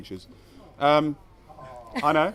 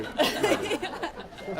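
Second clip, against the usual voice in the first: laughing; much slower than usual. Real, not acted. Laughing self-mocking repeat.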